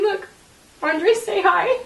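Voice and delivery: retelling a grieving mother's playful high voice; playful coaxing. Retold or imitated. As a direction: coax him to wave hello, half laughing. Mother coaxing dead son's arm.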